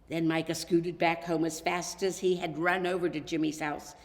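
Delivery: plain reading voice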